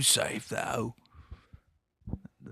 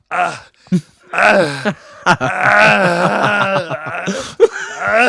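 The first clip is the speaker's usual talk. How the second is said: hammed-up pained yells, louder and higher; mock Arnold scream; 7 semitones higher, 12 dB louder, much slower than usual. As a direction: yell out in fake agony, laughing. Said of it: "it grabbed me, and it was like".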